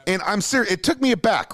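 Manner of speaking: normal talking voice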